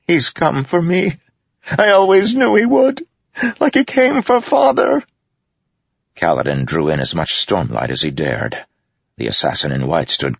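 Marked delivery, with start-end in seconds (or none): 0.0-5.0 high, panicked whimper of a king. A narrator's reading. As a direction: wail it in terror, voice pitched very high. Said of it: "King Elhokar's whimpering voice".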